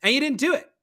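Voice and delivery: guy in wheelchair. Retold or imitated. Wheelchair user calls out the troupe for skipping him, mock-indignant. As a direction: needle them, pitch up on the accusation. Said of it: "And he goes".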